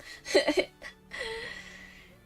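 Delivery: high squeaky giggle; laughing. Real, not acted. Streamer reacting to bards seducing a door, laughing. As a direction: burst into a high, breathy giggle.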